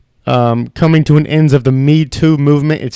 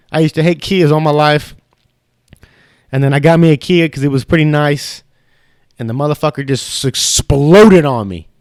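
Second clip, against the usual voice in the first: usual speaking voice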